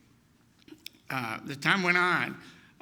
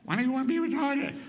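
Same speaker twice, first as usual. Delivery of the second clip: higher, disbelieving question; incredulous mother; 9 semitones higher than usual. Retold or imitated. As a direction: ask it as a stunned mother. Mother questions her son.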